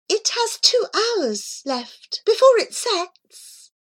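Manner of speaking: high, light voice of the cat. Acted. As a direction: voice the cat Miss Scurry high and airy. Cat answers Abu.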